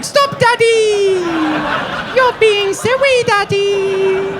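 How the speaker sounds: high, slow, teasing whine; bratty whine. Retold or imitated. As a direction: play coy like a spoiled child.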